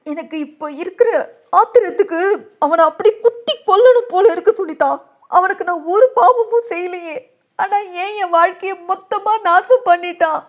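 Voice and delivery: high, anguished crying voice; tearful rage. A narrator's reading. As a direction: cry out with wounded fury. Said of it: "என்றாள் கண்ணீரோடு".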